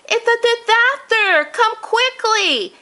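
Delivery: put-on high, alarmed old man's cry, slowing on come quickly; Grandpa shouting. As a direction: call for help like a frantic grandfather. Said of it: "He was still shouting".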